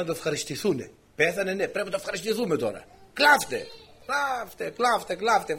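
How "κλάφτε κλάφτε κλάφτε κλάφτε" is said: high imitation of hired wailers; mock mourners' cry. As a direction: urge everyone to weep, high-pitched. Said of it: "φωνάζανε". (ψιλή μίμηση μοιρολογίστρας)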